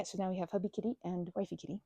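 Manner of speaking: ordinary talk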